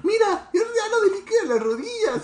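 very high put-on video voice; mock TikToker. Podcaster mimicking a viral knee-liquid TikTok, very high mock voice. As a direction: imitate an over-the-top viral clip in a high pitch.

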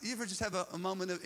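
ordinary delivery

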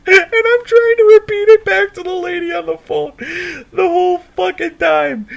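real high, squeaky laughing speech; laughing while talking. Real, not acted. Storyteller recalling the repeated address, high wheezing laughter. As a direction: squeal it out through helpless laughter.

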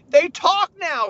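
high, loud, drawn-out shock; mock alarm. Man discovers the buttons talk, loud alarm. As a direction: blurt it in hammed-up panic.

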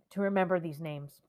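ordinary delivery

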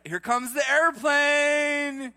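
put-on feed a toddler a spoon; airplane spoon game. Parent coaxing a child to eat, hammed-up sing-song.

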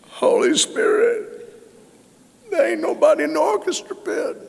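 hushed start rising to a high pleading whine; mock-whiny lament to the Spirit; complaining prayer. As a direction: complain to God like a hurt child. Preacher retells his complaining prayer.

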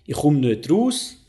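„ich komm nicht raus“ langsam, schweizerisch gefärbt, „raus“ steigend; Schweizerdeutsch vorgesprochen. Shown as a demonstration. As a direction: Mundartsatz deutlich vorsprechen. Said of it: "Wenn ich sagen will". (langsam im Schweizer Dialekt gesagt)

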